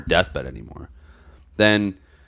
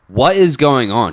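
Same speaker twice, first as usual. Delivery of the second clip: frustrated demand; 6 semitones higher, 8 dB louder, slower than usual. Retold or imitated. Frustrated call with attorney.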